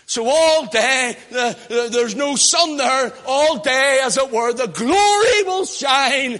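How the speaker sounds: loud, drawn-out pulpit proclamation; loud slow preaching. Acted. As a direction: proclaim each phrase slowly to the back pew.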